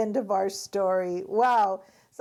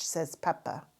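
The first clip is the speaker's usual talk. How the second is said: ordinary delivery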